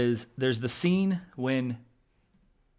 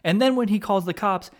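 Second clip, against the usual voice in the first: usual speaking voice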